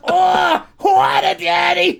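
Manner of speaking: high, loud, laughing shout; shrieking laughter. Real, not acted. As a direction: howl it out, helpless with laughter. Recalling the take, laughing hard.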